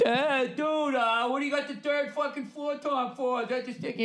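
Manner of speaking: high nerdy critic voice; high, snide mock drummer voice. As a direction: sneer like a know-it-all drummer. Mocking a critic of his drums.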